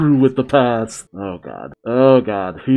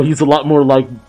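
normal talking voice

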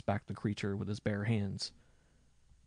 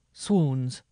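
loud, higher exclamation; sworn oath; 4 semitones higher, 9 dB louder than usual. A narrator's reading. Minstrel curses the cold wood, swearing out. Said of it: "swore one of the minstrels".

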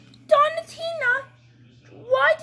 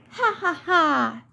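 lower, slow villain cackle; evil laugh; 6 semitones lower, slower than usual. Acted. Villain doll gloats.

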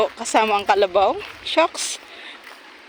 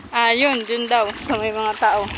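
ordinary talk

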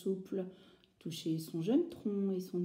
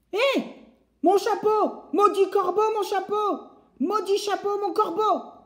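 angry woodcutter; 12 semitones higher, 8 dB louder than usual. Acted. Bûcheron perd son chapeau.

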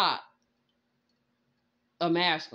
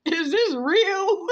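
laughing disbelief; 10 semitones higher, 5 dB louder, much slower than usual. Real, not acted. Laughing at absurd reading.

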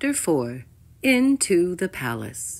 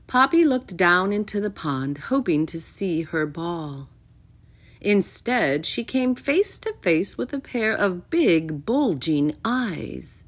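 ordinary delivery, nothing marked

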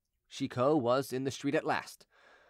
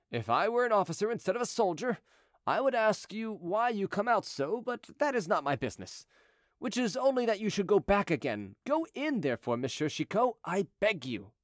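higher-pitched, gently insistent pleading; persuasive; 6 semitones higher than usual. A narrator's reading. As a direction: coax him to go back inside. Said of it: "said this in such a persuasive tone".

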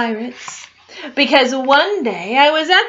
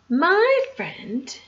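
ordinary delivery, nothing marked

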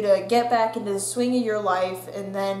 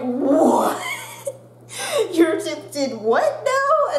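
higher, louder, slow incredulous question; teacher hearing the tooth fell out, incredulous; teacher's disbelief; 7 semitones higher, 4 dB louder, slower than usual. Retold or imitated. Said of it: "they're like".

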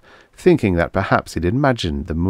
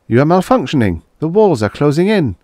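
frightened; 8 semitones higher, 5 dB louder, faster than usual. A narrator's reading. Trapped man confronts the ship.

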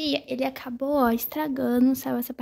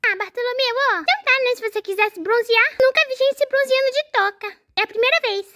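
put-on cheeky little boy, pitched up; high little boy voice; 10 semitones higher, 4 dB louder than usual. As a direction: play a teasing small boy. Voicing son doll.

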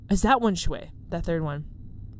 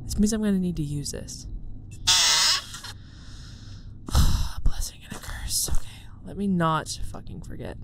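hushed whisper; 2 semitones lower than usual. Real, not acted. Whispering to viewers while hiding from the monster. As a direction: whisper it all, breathy and hushed.

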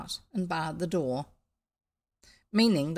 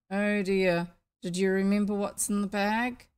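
plain reading voice

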